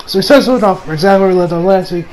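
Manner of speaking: usual speaking voice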